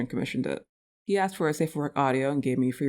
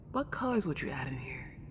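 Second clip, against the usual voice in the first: soft curious whisper; a confiding whispered question; 8 dB quieter than usual. Acted. Ghost whispers to the owner about new paint colours.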